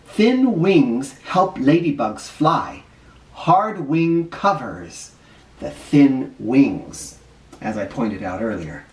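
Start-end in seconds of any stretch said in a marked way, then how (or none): none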